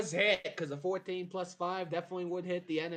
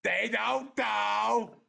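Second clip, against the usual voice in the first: loud, higher insistence; shouting; 7 semitones higher, 4 dB louder, much slower than usual. Real, not acted. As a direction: shout it at the player. GM insists loudly.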